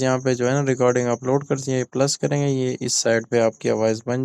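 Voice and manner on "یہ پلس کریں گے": usual speaking voice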